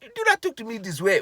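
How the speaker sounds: put-on very high, loud indignant oh-ohs in African accent; mock outrage in a hammed-up African accent. Husband scolding wife for objecting, high indignant mock African accent. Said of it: "Shut up with the voices".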